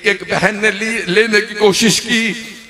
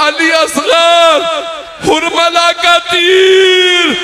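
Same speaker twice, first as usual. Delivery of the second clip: high, slow drawn-out wail; slow keening lament shout; wailing cry; 9 semitones higher, 4 dB louder, much slower than usual. Acted. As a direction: cry the name out long and high in grief. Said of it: "मेरा दिल कहता है मौला ने फरमाया होगा".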